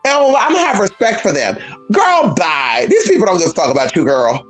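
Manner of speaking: ordinary delivery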